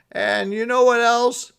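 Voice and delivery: high, loud put-on Santa voice. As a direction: play him sulky and fed up.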